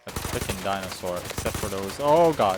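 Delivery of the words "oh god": startled loud outburst; alarmed. Real, not acted. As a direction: blurt it out in alarm. Reacting to a dinosaur attack.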